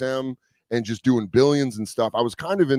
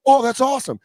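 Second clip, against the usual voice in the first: delighted fan; 12 semitones higher, 4 dB louder than usual. Retold or imitated. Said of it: "And he was like".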